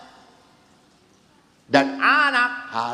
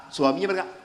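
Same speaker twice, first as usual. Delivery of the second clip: ordinary talk, nothing marked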